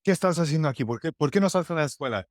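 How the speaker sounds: high, fast scolding in Spanish; a hammed-up novela mother, pitched high and rushed; Telemundo novela mom. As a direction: play a melodramatic soap-opera mother chewing out her kid. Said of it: "It was very Telemundo novela".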